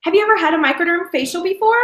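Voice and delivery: high, perky scripted question; cheery sales-call question; upbeat caller. Shown as a demonstration. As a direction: ask brightly, pitched well up. Demo caller's next scripted question.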